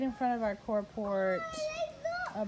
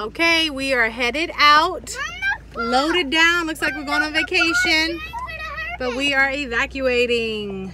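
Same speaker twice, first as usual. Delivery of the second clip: calling out; 5 semitones higher, 10 dB louder than usual. Real, not acted. Calling out while leaving home.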